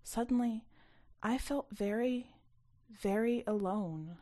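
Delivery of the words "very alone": usual speaking voice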